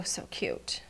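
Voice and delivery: "so cute" breathed out unvoiced, almost to herself; soft aside, "so cute" barely voiced; hushed whisper. Real, not acted. Murmured before the loud repeat.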